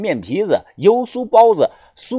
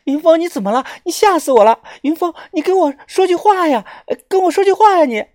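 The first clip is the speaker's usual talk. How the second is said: very high, pleading wail; acted tearful, panicked begging; crying and shouting; 14 semitones higher than usual. A narrator's reading. Friend begging the revived narrator to speak, crying out. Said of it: "小轩哭着喊着说啊".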